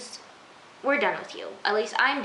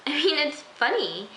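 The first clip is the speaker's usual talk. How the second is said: usual speaking voice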